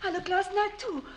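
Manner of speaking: high, soft frightened voice; clinging with relief after fear; wife, scared, reaching her husband in the dark forest; breathless relief. Acted.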